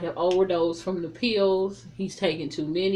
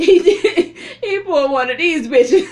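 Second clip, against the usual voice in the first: real laughter breaking through the words; high and loud, cracking up mid-sentence; laughing; 9 semitones higher, 12 dB louder than usual. Real, not acted. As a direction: laugh out loud while telling it. Laughing at her own joke.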